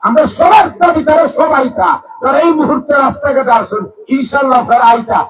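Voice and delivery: high, loud sermon shout; fiery waz preacher rallying listeners; preaching at full force. Acted. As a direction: rouse the crowd at full voice.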